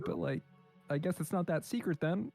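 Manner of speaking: ordinary talk, nothing marked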